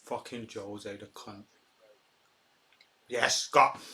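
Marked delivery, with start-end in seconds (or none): 0.0-1.4 bitter mutter. Real, not acted.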